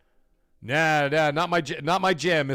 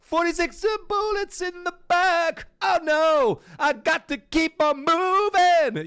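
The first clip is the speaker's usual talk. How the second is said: higher, slow mock voice of the unbothered enemy; 9 semitones higher, slower than usual. Gamer mimicking an unbothered enemy player, mock voice. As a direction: play the enemy as smug and unstoppable. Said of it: "he's just walking around like".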